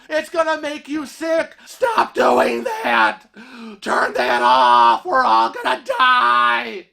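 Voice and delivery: high, strained hysterical scolding; mock panicked screaming. Stranger screaming at husband over air dryer, mock hysteria. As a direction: shriek it like an imitated germ-phobic alarmist. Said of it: "he starts screaming at Kevin".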